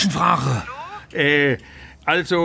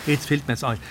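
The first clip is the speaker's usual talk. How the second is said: ordinary delivery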